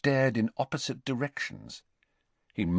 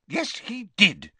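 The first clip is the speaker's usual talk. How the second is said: snapped; 11 semitones higher, slower than usual. A narrator's reading. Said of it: "snapped Ogden".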